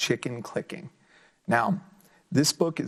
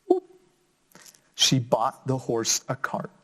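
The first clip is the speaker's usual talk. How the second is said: put-on lively, sing-song story reading for kids; reading to children; slower than usual. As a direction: bring the picture book alive for little listeners. Reads picture book aloud.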